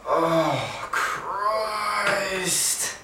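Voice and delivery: drawn-out weary oh Christ; exasperated groan. Retold or imitated. Passenger on the tram muttering, fed up with the ranter. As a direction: sigh it out, fed up.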